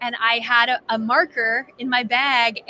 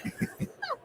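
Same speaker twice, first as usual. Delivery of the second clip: embarrassed laugh; 12 dB quieter than usual. Real, not acted. Embarrassed after awkward greeting.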